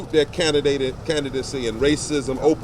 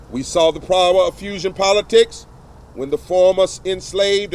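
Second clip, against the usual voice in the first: rising oratory, paced and emphatic, a touch louder; formal speech; 3 semitones higher, 3 dB louder than usual. Acted. Reverend reading an open letter to the press, in formal oratory. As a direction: preach the letter like a pulpit address.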